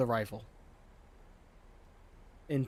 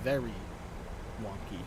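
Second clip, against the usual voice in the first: "wonky" dropped much softer and lower; deadpan, trail off on "wonky"; dry understatement; 3 semitones lower, 5 dB quieter than usual. Real, not acted. Pilot mutters to viewers, deadpan and much softer, about the air traffic control. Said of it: "Huh, what am I kidding?".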